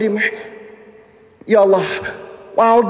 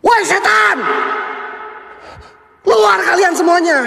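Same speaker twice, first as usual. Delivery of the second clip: shouting at spirits; 9 semitones higher than usual. Real, not acted. Streamer challenging the ghosts to appear, shouting. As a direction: yell them out of hiding.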